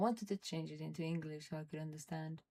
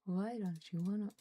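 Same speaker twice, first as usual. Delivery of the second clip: ordinary talk, nothing marked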